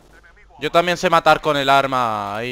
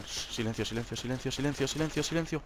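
near-whisper, very soft; hushed; 14 dB quieter than usual. Real, not acted. Sneaking gamer hushing himself, very soft. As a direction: hush yourself while sneaking.

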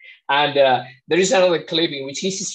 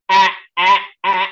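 three loud, higher, separate "ah"s; glottal onset "ah"; 5 semitones higher, 7 dB louder than usual. Retold or imitated. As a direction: hit each "ah" hard from the glottis. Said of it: "with your glottal onset".

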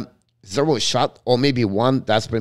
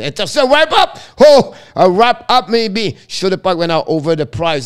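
high, loud burst of play-by-play; sudden rush of excitement at the net; excited call; 7 semitones higher, 7 dB louder than usual. Real, not acted. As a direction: shout the wraparound chance as it happens. Goalmouth scramble in live call.

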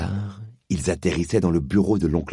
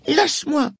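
very high yell; screamed; 5 dB louder than usual. Acted. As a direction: scream to be let go. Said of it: "Polly avait hurlé". (hurlé très aigu)

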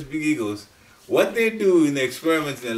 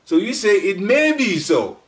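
may pushed louder and higher; stress on may; 7 semitones higher, 6 dB louder than usual. Shown as a demonstration. Teacher drilling the cautious scientific phrasing, stressing may. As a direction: slowly press the word may. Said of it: "you don't start by saying it is".

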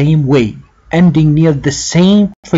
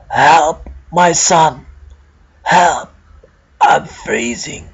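put-on trembling old man voice; 3 semitones higher, slower than usual. A narrator's reading. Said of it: "the trembling voice of the old man".

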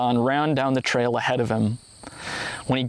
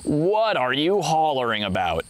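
put-on storyteller's puzzled old man; confused. A narrator's reading. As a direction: ask it with understated bewilderment.

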